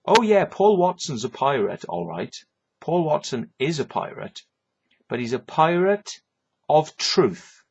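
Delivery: ordinary talk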